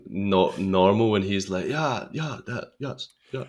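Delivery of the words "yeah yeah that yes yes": softer, higher mimicry of a posh English accent; mock a posh Englishman with repeated soft yeses. Friend imitating the film's lead, posh English put-on. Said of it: "is very posh".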